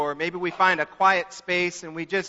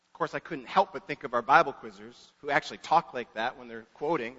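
ordinary talk

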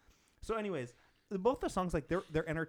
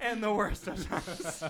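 laughing; 8 semitones higher, 5 dB louder, slower than usual. Real, not acted. Quoting Dickens while laughing.

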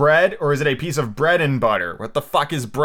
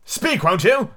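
high, insistent royal demand; king's cry; 10 semitones higher, 4 dB louder, much slower than usual. A narrator's reading. King demanding news from silent Hatta, high cry. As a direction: cry out for an answer. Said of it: "cried the king".

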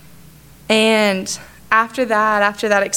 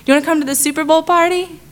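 higher, quicker, eager invitation; brightly asking him to come, higher and quicker; eager invite; 8 semitones higher, faster than usual. Retold or imitated. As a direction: pitch it up like a hopeful friend. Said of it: "I would ask him".

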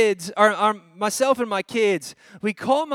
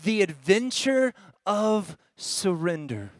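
slow and weighty, word by word; measured sermon-title delivery; slow, deliberate announcement; slower than usual. Real, not acted. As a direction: draw out each word of the title. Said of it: "I wanna say it like this".

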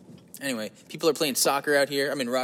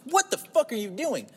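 much higher, slow self-scolding; inner voice; 11 semitones higher than usual. Retold or imitated. His own inner voice scolding him, slow and higher. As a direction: catch yourself mid-thought. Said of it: "that voice".

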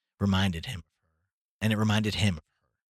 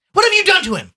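loud high accusing cry; angry cry; 11 dB louder than usual. A narrator's reading. Mother accusing the father, loud high angry cry. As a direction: demand it of him, furious. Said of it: "she cried".

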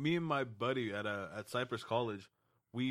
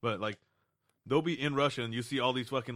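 ordinary talk, nothing marked

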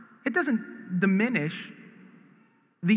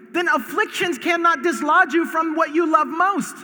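preaching climax; 4 semitones higher than usual. Acted. Rising climax of the sermon.

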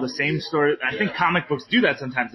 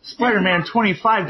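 normal talking voice

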